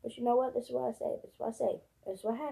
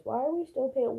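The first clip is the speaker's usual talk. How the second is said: ordinary talk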